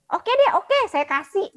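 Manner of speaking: giving in cheerfully. Retold or imitated. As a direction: throw up your hands and say yes gladly. Contractor gives in to request.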